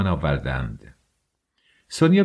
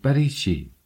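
startled, pitched-up why; surprised question; 6 semitones higher than usual. A narrator's reading. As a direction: ask it in sudden surprise. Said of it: "سونیا با تعجب پرسید".

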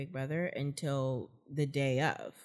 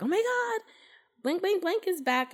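excited fan. Retold or imitated. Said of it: "the diehards are like".

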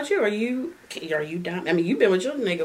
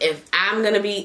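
ordinary delivery

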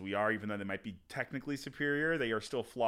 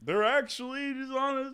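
mock voice; 13 semitones higher, 4 dB louder than usual. Mocking high voice.

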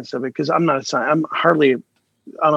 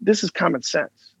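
usual speaking voice

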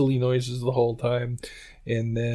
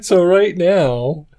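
high, slow, breaking into laughter; can't get through it for laughing; 6 semitones higher, 4 dB louder, slower than usual. Real, not acted. Laughing fit mid-apology.

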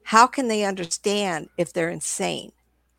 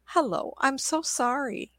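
slow, smiling, higher sympathetic hello; smiling apology; 6 semitones higher, slower than usual. Shown as a demonstration. Service rep soothing an angry caller, slow smiling apology. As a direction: smile through the words to calm a caller. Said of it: "always smile".

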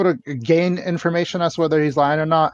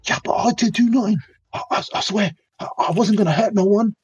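higher, nervous stammering plea; pleading suspect; 7 semitones higher than usual. Acted. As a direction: stammer out your innocence. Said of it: "he leans in like".

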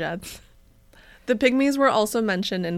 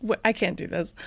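normal talking voice

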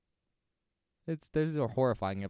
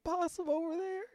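high squeaky joke voice. Pretend. Absurd joke request mid-bit.

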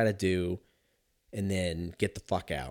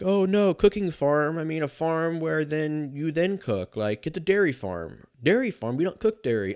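higher, scrambling excuses; flustered backpedaling; 6 semitones higher, 3 dB louder than usual. Retold or imitated. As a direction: cover the blunder in a panic. Crasher panics, covering his lie.

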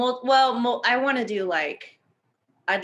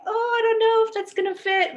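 voicing the bubble; 5 dB louder than usual. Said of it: "the bubbles will be like".

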